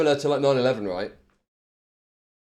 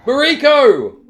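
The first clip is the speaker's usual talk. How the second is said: higher, loud imitating yell; mock shout; 10 semitones higher, 10 dB louder, slower than usual. Streamer copying a shouting man from the news, mock shout. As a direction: echo the clip's cry.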